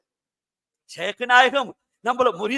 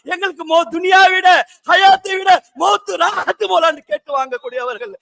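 raised sermon voice, pressing onward; fervent preaching; 6 semitones higher than usual. Real, not acted. Preacher praising those who long to meet God, fervently. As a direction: drive the point home to the congregation.

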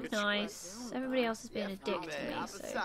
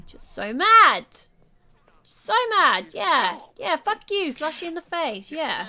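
hammed-up angry yelling, much louder; mock rage; 4 semitones higher, 11 dB louder, slower than usual. Gamer voicing her angry sim shouting at a rival. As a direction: shout it like a petty brawl.

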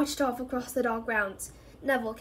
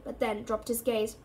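ordinary delivery, nothing marked

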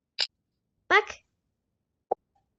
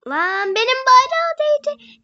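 exasperated; 9 semitones higher, 6 dB louder, slower than usual. Real, not acted. Annoyed at game flag.

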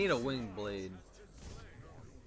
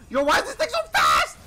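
loud high disbelieving shout; 13 dB louder than usual. Real, not acted. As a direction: yell it in shocked disbelief. Shocked at fast enemy.

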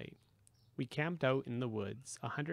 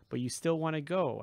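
louder, disbelieving question; amazed; 4 semitones higher, 5 dB louder than usual. A narrator's reading. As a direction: say it like you can't quite believe it. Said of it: "I said, amazed".

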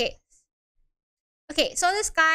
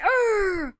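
acted higher-pitched "urgh"; disgusted groan; 6 semitones higher than usual. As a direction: groan it out in mock exasperation. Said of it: "Vince Eeks is like".

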